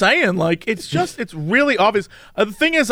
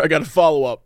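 ordinary talk

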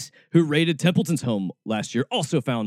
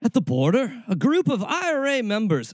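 attempted Irish accent; 11 semitones higher than usual. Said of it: "I'm bad at accents".

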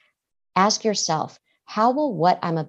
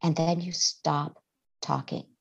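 ordinary talk, nothing marked

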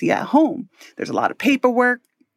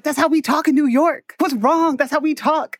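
defensive protest; 7 semitones higher than usual. Retold or imitated. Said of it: "So I'm over here like".

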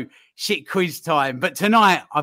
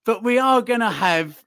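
ordinary talk, nothing marked

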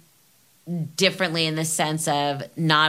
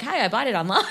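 bright and plain; 4 semitones higher, faster than usual. Pretend. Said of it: "not like".

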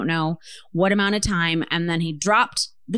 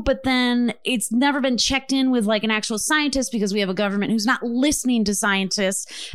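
ordinary delivery

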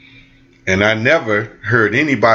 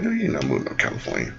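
stern mom; 9 semitones lower, 10 dB quieter, faster than usual. Retold or imitated. Mom refusing son, dropped low.

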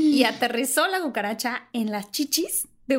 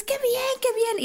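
high chirpy praise; mock delighted chorus; gushing approval; 13 semitones higher than usual. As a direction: coo it like fans. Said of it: "Y todos".

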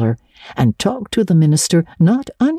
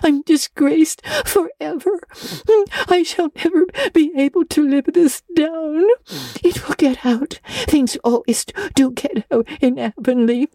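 put-on despairing girl; 13 semitones higher than usual. A narrator's reading. Anne weeping on bed.

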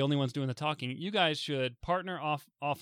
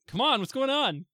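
impatient; 10 semitones higher, 5 dB louder than usual. Retold or imitated. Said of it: "And you're just like".

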